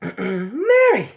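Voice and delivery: Mary snapped out loud and sharp. Acted. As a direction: cut in sharply, commanding. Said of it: "rather sharply".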